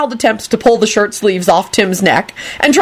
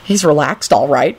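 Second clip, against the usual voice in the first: usual speaking voice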